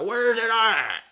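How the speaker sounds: loud, high, drawn-out holler; challenging yell. Retold or imitated. Husband hunting the bear outside, long loud challenging holler. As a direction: stretch it into a hammed-up yell.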